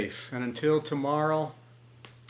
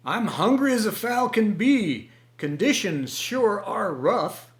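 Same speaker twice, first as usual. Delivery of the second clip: hungry rooster complaining, higher and louder; loud grumbling rooster lament; rough growl; 9 semitones higher, 7 dB louder, slower than usual. A narrator's reading. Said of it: "he growled in accents rough".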